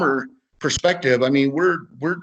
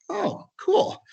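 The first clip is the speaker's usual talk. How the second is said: startled squeak. Retold or imitated. Surprised reply to stranger paying.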